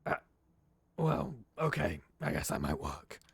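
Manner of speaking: lightly voiced gruff old detective; a hint of a cigar-smoking Nosferatu contact; gravelly D'Angelo. D'Angelo hauling the player into the alley, lightly gruff.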